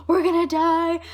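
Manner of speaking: high, loud mock wail; imitated near-tears panic. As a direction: play a friend freaking out on the verge of tears. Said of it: "She's on the verge of tears".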